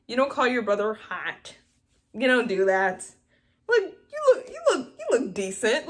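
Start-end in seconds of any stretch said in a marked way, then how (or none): 3.7-5.9 much higher, hesitant put-on voice; mock awkward sibling. Vlogger shows how a sister should compliment her brother, higher and halting. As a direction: act out a stiff sibling compliment.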